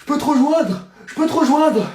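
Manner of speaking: loud, high call to another room. Acted. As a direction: shout it through the door. Calling to partner in the shower.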